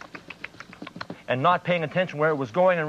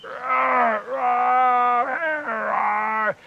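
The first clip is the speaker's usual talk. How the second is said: higher, louder trapped roaring; desperate lion roars; 8 semitones higher, 4 dB louder, much slower than usual. Acted. Trapped lion roaring for anyone to hear. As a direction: roar for help as loud as possible. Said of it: "roaring as loud as he could".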